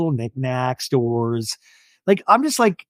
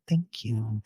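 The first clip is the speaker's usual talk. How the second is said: much softer, lower thank you; quiet Shannon; 6 semitones lower, 10 dB quieter than usual. Retold or imitated. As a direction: mumble it shyly. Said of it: "like the quiet Shannon".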